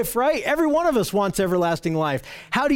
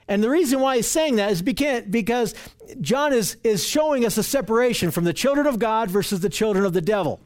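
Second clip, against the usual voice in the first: usual speaking voice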